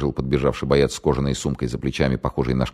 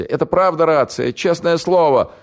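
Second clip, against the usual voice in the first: put-on much higher, louder panicky young man; scared radio operator; 13 semitones higher, 4 dB louder than usual. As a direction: blurt it out in terror. Голос радиста. (испуганный пленный радист, высоко и громко)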